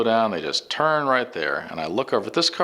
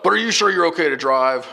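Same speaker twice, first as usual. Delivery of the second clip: raised, louder mock-cop voice; imitated questioning cop; 9 semitones higher, 5 dB louder than usual. Cops checking a driver before release, insistent questioning. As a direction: pitch it up as a doubting officer.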